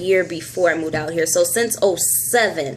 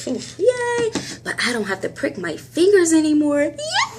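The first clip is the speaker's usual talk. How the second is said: giddy celebration; 8 semitones higher than usual. Real, not acted. Celebrating dropping finger pricks.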